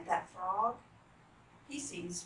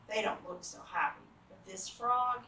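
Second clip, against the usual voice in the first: plain reading voice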